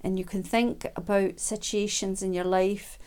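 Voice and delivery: usual speaking voice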